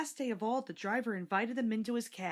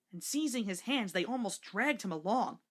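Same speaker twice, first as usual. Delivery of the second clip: plain reading voice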